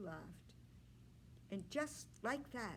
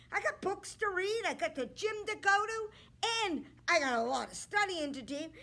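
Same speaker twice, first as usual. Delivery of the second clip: loud, higher bragging suitor voice; a cocky prince showing off; boastful suitor; 5 semitones higher, 10 dB louder than usual. Acted. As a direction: puff up like a vain young man. Suitor lists his busy life.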